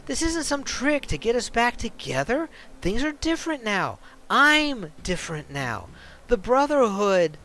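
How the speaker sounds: urgent, worried man begging her to leave; pleading ex. Acted. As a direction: warn her like her life depends on it. Ex voices cult pitch.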